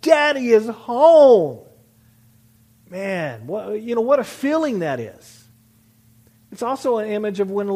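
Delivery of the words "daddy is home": loud, drawn-out mock child's cheer; excited child. Little boy greets returning father, excited imitation. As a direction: imitate a thrilled boy calling out, slowly and loudly. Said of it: "He's so excited I am there".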